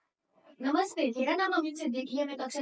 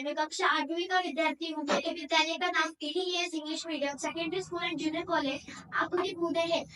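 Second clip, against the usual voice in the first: usual speaking voice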